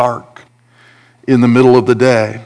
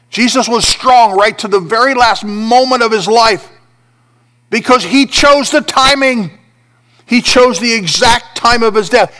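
high, louder, fervent preaching; preaching at full force; 9 semitones higher, 3 dB louder than usual. Acted. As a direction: proclaim it like a triumph. Preacher peaks in intensity.

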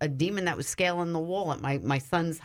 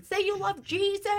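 much higher, pressing order; urgent command. Retold or imitated. Speaker urging a possessed woman, high and urgent. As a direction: insist she say it now. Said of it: "I'm saying".

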